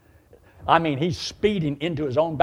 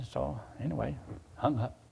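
ordinary talk

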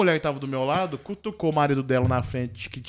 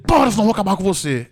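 mock angry father yelling; retold yell; 3 semitones higher, 8 dB louder than usual. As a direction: yell at the child to stop. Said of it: "deu esse gritão com a criança".

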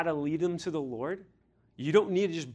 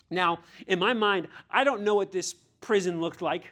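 normal talking voice

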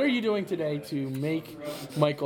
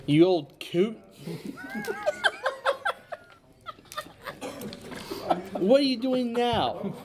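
put-on old couple teasing; 7 semitones higher, slower than usual. Neighbor scolding Leroy in character.

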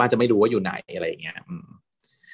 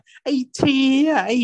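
high, slow, exasperated cry of a name; retold annoyance, pitched high; mock annoyed; 14 semitones higher, slower than usual. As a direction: act fed up with the friend. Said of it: "โอ๊ย หัวเสีย".

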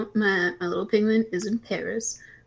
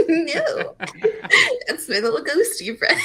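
laughing; 10 semitones higher, 6 dB louder than usual. Real, not acted. Laughing about the ghost toy.

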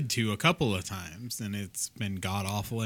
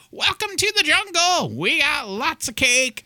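mock singer voice, much higher and louder; 9 dB louder than usual. Mocking the bloated singer at the concert, shrill and high. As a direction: squeal it out like a worn-out rock singer. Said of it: "And he's like".

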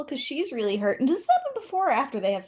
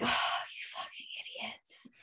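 a hissed aside calling them idiots; scornful whisper; 14 dB quieter than usual. Retold or imitated. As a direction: whisper it under your breath, contemptuous. She mutters scornfully under breath.

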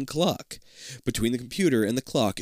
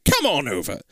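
raised put-on scientist voice; eccentric Doctor Peebles; 4 semitones higher than usual. Scientist starting the experiment with a volunteer, urgent. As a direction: urge them on in character.

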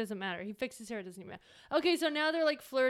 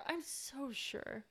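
slow, soft, drawn-out sarcastic drawl; 12 dB quieter, much slower than usual. Shown as a demonstration. Friend mocking the on-screen romance, slow sarcasm. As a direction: drag it out, deeply unconvinced.

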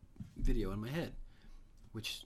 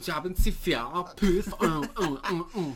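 mock German; 8 semitones higher, 11 dB louder, slower than usual.

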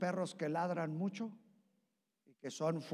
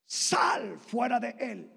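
loud, slow and higher command to the spirit; shouted command; 6 semitones higher, 8 dB louder, much slower than usual. Retold or imitated. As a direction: bark it out slowly like casting out a demon. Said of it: "y le gritó". (orden gritada, lenta y aguda)

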